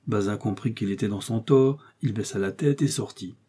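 plain reading voice

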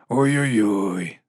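low, slow, drawn-out groaning reproach; dismayed drawl. A narrator's reading. Captain Vimes reacts to Nobby's scheme, drawn-out dismay. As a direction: drag it out low and slowly.

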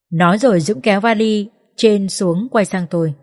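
ordinary delivery, nothing marked

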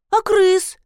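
put-on high childlike protest; pitched well up, insistent little voice; little one asking. A narrator's reading. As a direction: ask it like a child being abandoned. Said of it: "спросила киса".

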